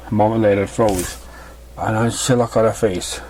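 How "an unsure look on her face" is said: ordinary delivery, nothing marked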